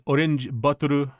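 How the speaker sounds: "orange bottle" said as o-ren-ji botoru; "bottle" stretched to Japanese-style bo-to-ru; faked Japanese. Shown as a demonstration. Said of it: "that's kind of faking it".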